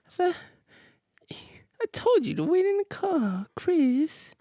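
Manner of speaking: very high falsetto, whispered in the middle. Retold or imitated. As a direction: breathe the scolding up high, almost weepy. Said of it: "How do you do that?".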